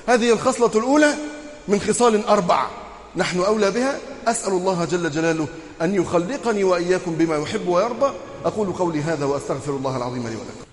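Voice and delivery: usual speaking voice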